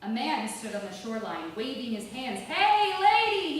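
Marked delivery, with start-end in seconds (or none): 2.4-3.6 loud, high shout across the water. A narrator's reading. Man on the shore shouting to the woman in the river. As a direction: yell out to the stranger from the shore. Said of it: "he shouted".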